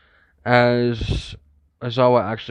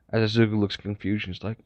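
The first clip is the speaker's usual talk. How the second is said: usual speaking voice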